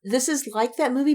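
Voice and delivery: usual speaking voice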